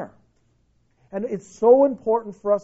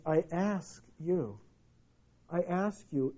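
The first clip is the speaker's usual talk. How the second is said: soft appeal; 4 semitones lower, 11 dB quieter, slower than usual. Real, not acted. Quiet earnest appeal.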